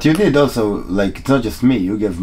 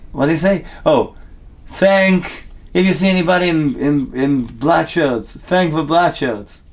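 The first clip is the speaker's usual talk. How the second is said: stage call-out; 6 semitones higher, slower than usual. Retold or imitated. Comic retelling own stage line, calling out.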